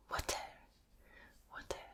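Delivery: "water" with a crisp pronounced t; South African accent. Shown as a demonstration. Host demonstrating South African t sounds, whispered accent. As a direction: give "water" a clear, sharp t. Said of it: "They pronounce the T's".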